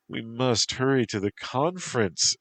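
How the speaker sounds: high-pitched, mannered protest. A narrator's reading. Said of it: "she protested".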